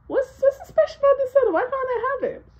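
put-on high, loud, fast whiny kid; bratty kid voice. Kid demanding to know about the soda. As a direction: whine the demand quickly.

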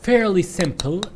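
drawn out. Real, not acted. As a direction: stretch it out. Reassuring himself slowly. (كلام ممطوط ببطء شديد)

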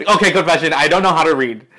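loud, laughing admission; laughing confession. Real, not acted. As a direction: laugh it off at yourself. Laughs at own misreading.

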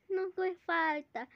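ordinary talk, nothing marked

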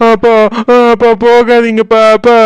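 high sobbing pleas; crying for father. Acted. Weeping daughter calling her father back, sobbing. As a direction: cry out as father leaves. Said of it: "நீயே அழுதுட்டு இருக்க".

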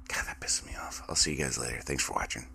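soft whispered aside; whispering. Real, not acted. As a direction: whisper it under your breath. Signing off, muttering aside.

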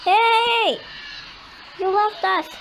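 loud, high protest; indignant shout. Real, not acted. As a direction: shout after someone who left you behind. Protesting at being left behind.

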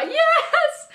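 very high, laughing squeal; thrilled squeal. Real, not acted. Vlogger getting favorite nail polish, thrilled squeal. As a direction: squeal it out in delight.

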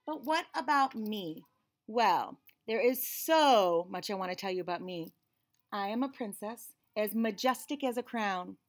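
put-on lively storybook reading in a child's narrating voice; picture book read-aloud. A narrator's reading. Reading a picture book to children, lively and bright. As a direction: read it like the kid telling the story.

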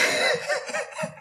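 high burst of laughter. Real, not acted. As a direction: crack up laughing. Teller cracks up at own story.

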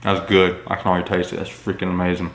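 small bite in mouth; eating and tasting aloud; talking while chewing. Real, not acted. Seen 0: Put seaweed in mouth at -3; talks while still eating.

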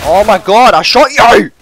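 loud outraged protest, rising on YOU; exasperated loud complaint; indignant yell. Real, not acted. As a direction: shout it in disbelief at the game. Disputes a missed kill.

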